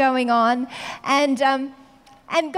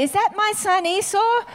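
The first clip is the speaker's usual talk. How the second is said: higher, lightly voiced old father; old blind father asking; 8 semitones higher than usual. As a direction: ask like a doubting old dad. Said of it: "Israel would say".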